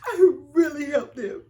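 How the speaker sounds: tearful, loud and breaking voice; crying. Real, not acted. Insisting to the interviewer she cared, crying. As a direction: push the words out through real tears.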